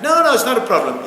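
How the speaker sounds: loud, high dismissive protest; mock denial. Voicing those who deny the problem, mock loud denial. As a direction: burst in, waving the objection away loudly.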